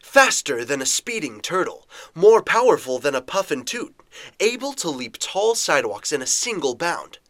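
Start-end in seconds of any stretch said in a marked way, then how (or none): none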